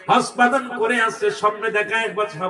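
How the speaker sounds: ordinary talk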